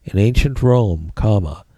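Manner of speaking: dictating. Shown as a demonstration. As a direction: spell out the notes for students. Dictating notes to the class.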